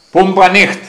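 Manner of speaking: high, loud, broken German, πούμπα stressed; broken German to a giant. Retold or imitated. Traveller calling to the giant through the window, loud broken German. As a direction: shout simple words so he understands. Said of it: "λέω του γίγαντα".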